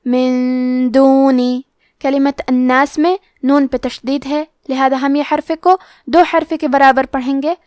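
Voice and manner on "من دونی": من دونی recited slowly with the نون hidden in ghunna. Shown as a demonstration. Teacher demonstrating ikhfa to students, slow chant. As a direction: draw out من دونی with a held ghunna. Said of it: "یہ حکم کو غنہ کے ساتھ ادا کریں گے".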